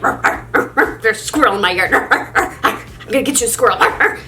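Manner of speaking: whispered ruffs, quick dog chatter; dog at the window, light imitated barking; playful dog barking. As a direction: bark out the words like an eager dog.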